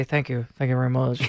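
low-pitched mock [name] thank-you. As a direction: do a playful [name] sign-off. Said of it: "hard for him to say".

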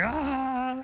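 long, high "ahh" of put-on enjoyment; mock blissful sigh. Mocking someone enjoying a whole flight, a blissful ahh. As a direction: draw out a high, contented ahh. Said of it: "just enjoying yourself the whole time".

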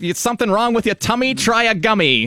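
high, rhyming huckster pitch; snake oil seller. Retold or imitated. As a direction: sell the cure like a barker. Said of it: "[name]".

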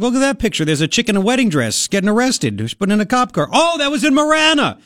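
high-pitched reenacted amazement; excited retelling. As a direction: relive spotting it, voice leaping up. Retells excited reaction to the picture.

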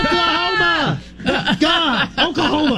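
high, stretched-out pretend holler; hammed-up exasperated yell across a party; mock yell. Yelling at the relative across a party, mock yell. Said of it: "yelled across a party".

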